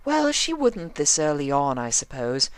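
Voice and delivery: prim fashionista scolding a fidgety friend; Rarity's voice. A narrator's reading.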